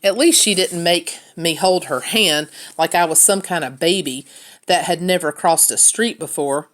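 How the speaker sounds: ordinary delivery, nothing marked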